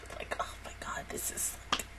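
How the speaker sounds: hushed secret whisper. Real, not acted. To viewers, teasing the finale in a whisper. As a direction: breathe it like a spoiler secret. Teasing a coming plot twist.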